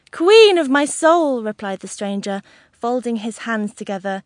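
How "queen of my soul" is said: high, loud, slow declaration of love; theatrical old gentleman wooing a lady; ardent suitor. A narrator's reading. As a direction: draw it out adoringly. Stranger declares love over the wall.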